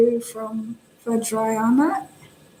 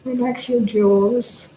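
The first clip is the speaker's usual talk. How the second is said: calm body-scan voice, long silences between phrases; guided meditation. Real, not acted. Teacher guiding meditators to relax the face, calm and unhurried. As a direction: guide them gently, leaving space after each instruction.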